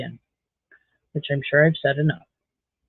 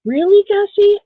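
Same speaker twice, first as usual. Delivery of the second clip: much higher, louder exasperation at the dog; pitched-up exasperated scolding; 14 semitones higher, 8 dB louder than usual. Real, not acted.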